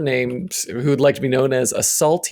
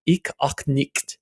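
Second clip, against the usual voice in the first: put-on German accent; 7 dB quieter than usual. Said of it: "he tells me".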